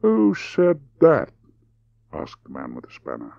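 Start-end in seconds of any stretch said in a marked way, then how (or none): 0.0-1.3 high, deliberate drawl; drawled question, pitched high; slow drawl. Acted. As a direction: stretch each word out slowly. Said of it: "He spoke deliberately in a slow drawl".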